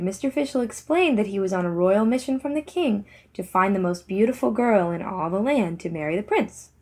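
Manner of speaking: plain reading voice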